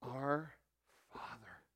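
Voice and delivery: hushed whisper of Father. Acted. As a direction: breathe the word softly. Tender whispered name for God.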